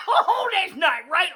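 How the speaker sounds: laughing high and loud through the words; real laughter. Real, not acted. Storyteller laughing at the end of his joke, loud. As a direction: crack up at your own punchline.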